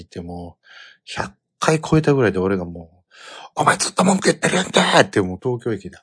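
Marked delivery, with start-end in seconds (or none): 3.6-4.8 loud, fast scolding yell, higher; angry shout. Retold or imitated. Father yelling at his whining son in the station, loud shout. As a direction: snap at him loudly and quickly. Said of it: "もうものすごい声で".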